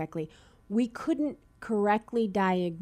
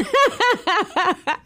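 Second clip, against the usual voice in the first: amused laugh; 12 semitones higher, 7 dB louder than usual. Real, not acted. Laughing at own Boston accent.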